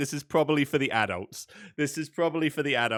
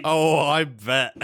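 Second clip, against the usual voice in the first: slow, louder knowing reply; knowing drawl; 6 dB louder, much slower than usual. Real, not acted. Agreeing the internet had a field day, slow and loud. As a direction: lean on it knowingly. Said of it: "reacting to Rule 34 joke".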